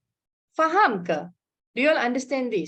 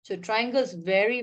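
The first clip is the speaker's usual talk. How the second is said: ordinary talk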